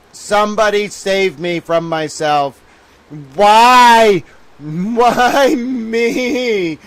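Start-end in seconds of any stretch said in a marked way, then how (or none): 3.3-6.8 loud, hammed-up wail; theatrical why-me howl; mock cry to heaven. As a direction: fling the question at the sky. Dramatic lament to the heavens.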